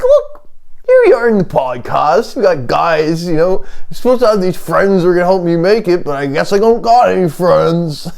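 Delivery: high-pitched, hammed-up joke voice; whine-free but high mock "real voice"; supposed real voice. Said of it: "imagine I used my real voice".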